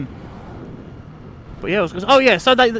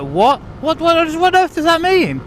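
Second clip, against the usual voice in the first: higher-pitched, mock-bewildered outburst; baffled disbelief; 10 semitones higher than usual. Newcomer reacting to vague Devon time, baffled disbelief. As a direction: stammer on what, rising into bafflement. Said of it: "And it's just like".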